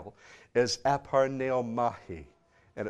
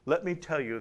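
ordinary delivery

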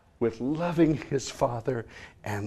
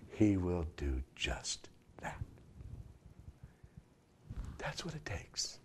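whispered, much softer; 4 semitones lower, 11 dB quieter than usual. Real, not acted. Preacher invites sinners to repent, in a soft whisper. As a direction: lean in and whisper it.

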